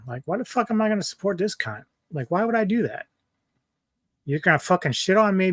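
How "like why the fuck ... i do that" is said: higher, scornful mock inner voice; a lightly voiced mock disbelief, pitched up; mocking incredulous quote. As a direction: sneer it like a fed-up creator. Mocking what creators would think.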